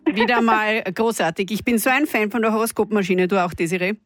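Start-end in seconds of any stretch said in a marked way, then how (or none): none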